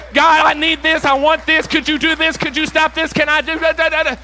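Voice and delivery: high, loud barrage of requests; hammed-up nonstop begging at God; frantic demanding prayer. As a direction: rattle off pleas without leaving a gap. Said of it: "That is my prayer life sometimes".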